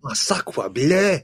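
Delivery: slow, high hammed-up French; mock Frenchman exclaiming; French accent. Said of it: "French impression".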